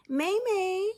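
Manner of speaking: high, bright mother calling out. Acted. Mother calling to daughter at school, high and eager. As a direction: sing out her daughter's name. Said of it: "Ming cried".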